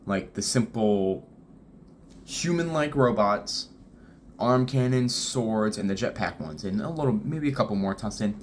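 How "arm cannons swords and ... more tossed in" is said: ordinary talk, nothing marked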